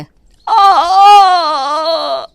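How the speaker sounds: sharp pained cries, high and loud; crying out in pain. Acted. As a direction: yelp as hot tea scalds you. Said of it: "Savita चिल्ला उठती है". (जलने पर ऊँची दर्द भरी चीख)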